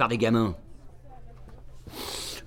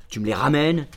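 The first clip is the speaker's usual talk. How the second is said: ordinary talk, nothing marked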